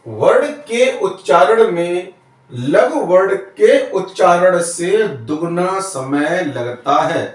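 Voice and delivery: the definition read out word by word, slowly; slow, careful classroom dictation. Shown as a demonstration. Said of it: "teacher dictates the definition slowly".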